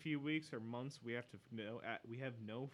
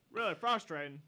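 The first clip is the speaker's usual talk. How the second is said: loud, high mock English accent; badly faked English accent, pushed loud and high; 6 semitones higher, 10 dB louder than usual. Quoting Rennie on the rat hunt, bad English accent. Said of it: "that's the worst accent".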